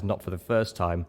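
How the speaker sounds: usual speaking voice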